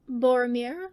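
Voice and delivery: plain reading voice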